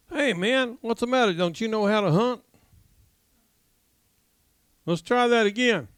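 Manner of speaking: high-pitched, exasperated ribbing; mock scolding hunter. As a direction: chide a hopeless hunting partner. Cajun scolds banker in acted joke.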